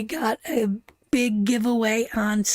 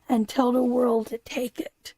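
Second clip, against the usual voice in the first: usual speaking voice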